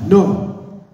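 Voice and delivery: loud, high no to stop him; wife stopping husband, loud high shout; sharp shout. Retold or imitated. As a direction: yank your husband back, yell it. Wife pushes husband away.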